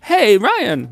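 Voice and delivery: high, loud quoted call-out; imitating a Vine. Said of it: "You know that Vine with Ry".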